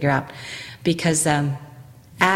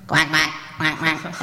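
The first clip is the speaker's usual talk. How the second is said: nasal duck quack; slower than usual. Shown as a demonstration. Coach imitates the Aflac duck for the actors, nasal quacks. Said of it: "the Aflac sound".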